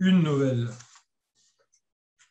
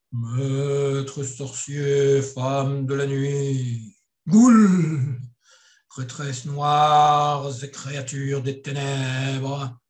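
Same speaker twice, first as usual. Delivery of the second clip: slow, grave ceremonial address; solemn; 3 dB louder, much slower than usual. Acted. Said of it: "dit solennel".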